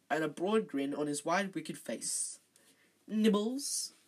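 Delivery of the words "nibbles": high, sweetly rising offer; mock-sweet question, lifted high. A narrator's reading. Peeves offering snacks to the children, sweetly. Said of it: "he said sweetly".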